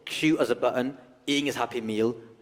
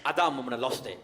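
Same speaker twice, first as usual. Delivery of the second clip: ordinary delivery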